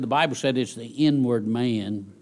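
ordinary talk, nothing marked

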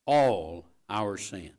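drawn-out stress on All our sin. Shown as a demonstration. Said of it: "could I just put a little more emphasis".

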